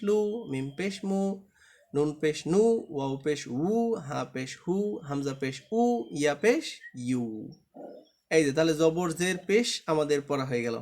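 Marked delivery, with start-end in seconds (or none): none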